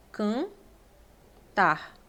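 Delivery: "cantar" said slowly, with a nasal A and no N sound; nasal A demo. Shown as a demonstration. Teacher modelling "cantar" for the class, slow and nasal. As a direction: draw out "cantar" so only the nasal vowel is heard. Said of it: "Você escuta".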